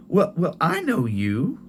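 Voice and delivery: put-on eager old mother recognizing her grandson; grandma's voice. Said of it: "I was like".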